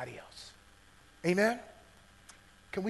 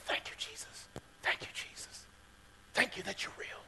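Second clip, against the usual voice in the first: hushed prayer; 14 dB quieter than usual. Retold or imitated. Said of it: "he begins to".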